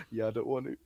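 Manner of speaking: very soft, low mimicked refusal; mock meek refusal. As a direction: imitate a sheepish turn-down, barely voiced. Friend mimics his meek refusal.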